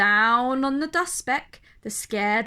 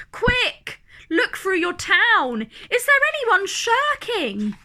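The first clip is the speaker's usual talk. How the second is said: urgent prod; 6 semitones higher than usual. A narrator's reading. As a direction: push him to hurry. Horton urges the mayor.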